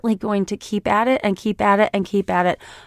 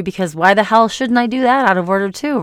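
usual speaking voice

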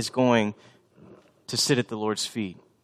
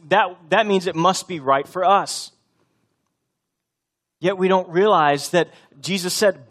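louder, higher, driving preacher's voice; full-force preaching; 6 semitones higher, 7 dB louder than usual. Acted. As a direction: preach it out to the congregation.

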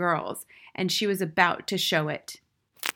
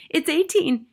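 high, louder hurried correction; boy's cry; 10 semitones higher, 6 dB louder than usual. A narrator's reading. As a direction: cry out as a worried pupil. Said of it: "he cried".

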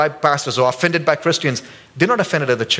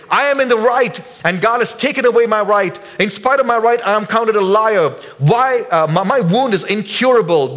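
Job protesting; 6 semitones higher than usual. Acted. Job accusing God of being unfair. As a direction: plead your innocence, wronged. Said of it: "he's quoting Job again".

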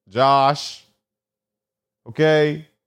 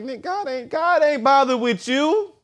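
higher, mocking brush-off; dismissive street voice; 10 semitones higher than usual. Talking down to the homeless preacher, mocking brush-off. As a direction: wave him off like he's nothing.

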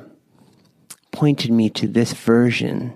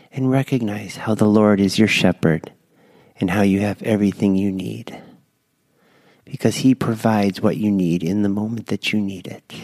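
guided breath prayer. Acted. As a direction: lead them gently through each breath.

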